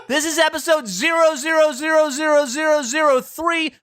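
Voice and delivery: high, bright host introduction; podcast host voice. Acted. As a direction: announce the show and guests.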